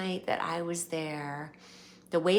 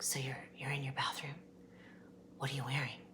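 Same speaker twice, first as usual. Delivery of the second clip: imitating [name]'s whisper; 4 semitones lower, 13 dB quieter, much faster than usual.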